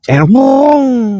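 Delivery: excited whoa. Retold or imitated. Tearing down the dirt road.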